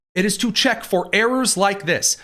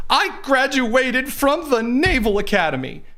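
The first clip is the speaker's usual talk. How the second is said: mock proud; 8 semitones higher, slower than usual. Mocking the governor's argument.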